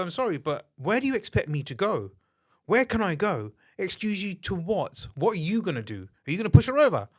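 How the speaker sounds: retold argument that keeps climbing; heated retort. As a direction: tell off the pushy woman. Retold row in the shop.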